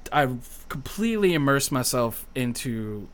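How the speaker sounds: usual speaking voice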